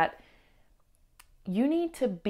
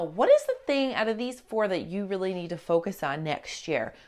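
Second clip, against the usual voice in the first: ordinary talk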